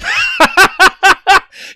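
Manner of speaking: high loud laugh. Real, not acted. Laughing at own impression.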